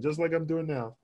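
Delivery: ordinary delivery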